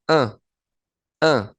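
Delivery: "A" as one nasal French vowel; IN nasal sound. Shown as a demonstration. Teacher sounds out French IN for Spanish speakers, nasal "A". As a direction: say "A" through the nose, no N closure. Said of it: "La I N, nosotros sabemos que suena".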